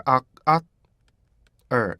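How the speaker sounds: "악, 악, er" as three clear beats. Shown as a demonstration. Teacher splitting "are" into three beats. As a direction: break "are" into three parts. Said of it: "이렇게 되죠".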